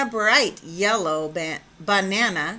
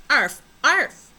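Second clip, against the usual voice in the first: higher, louder mock puppy bark; barking like a dog; 5 semitones higher, 6 dB louder than usual. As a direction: yap it out like a little dog. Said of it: "barked Jujubee".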